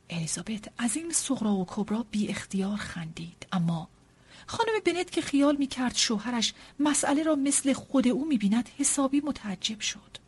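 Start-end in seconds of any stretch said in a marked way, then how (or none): none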